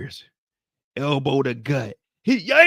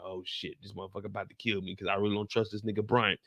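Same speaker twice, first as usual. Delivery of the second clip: much softer, lower worried mutter; hushed fear; 5 semitones lower, 8 dB quieter than usual. Retold or imitated. Jen hiding from Henry, much softer worried mutter. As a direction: keep it down so nobody hears.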